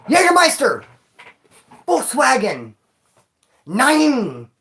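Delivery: high, loud fake German barking; mock German commands. Joking to viewers about the police dog, loud mock German shouts. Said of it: "'Cause they speak German".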